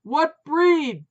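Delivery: high, loud shout. A narrator's reading. Putz shouting at wife over barking, loud. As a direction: bellow the question. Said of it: "Putz called out".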